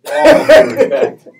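laughing through the words; laughing, loud and slow. Real, not acted. Sarcastic caveat after an insult.

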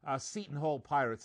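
usual speaking voice

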